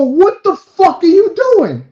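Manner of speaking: high, loud, slow outrage; angry yell. Retold or imitated. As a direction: yell in disbelief at a queue-jumper. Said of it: "I mean, I yelled".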